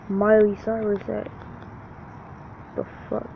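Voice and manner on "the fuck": whisper. Real, not acted. Whispered aside.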